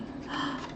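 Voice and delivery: a soft, breathless gasp; real soft gasp. Real, not acted. Listener gasping at the fall, soft. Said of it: "listener reaction".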